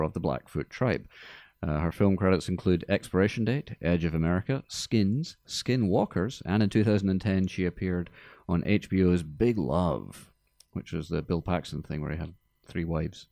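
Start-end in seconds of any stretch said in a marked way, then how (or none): none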